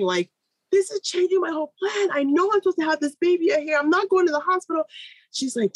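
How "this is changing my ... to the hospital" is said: high, strained upset voice, imitating herself crying; retold bawling. Mother on the phone to her midwife, upset, high and close to tears. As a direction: replay the tearful panic, pitched up. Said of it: "so I start bawling".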